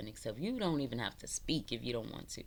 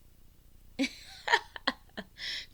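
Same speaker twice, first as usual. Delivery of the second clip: high cackling laugh. Real, not acted. Cackling at her own joke.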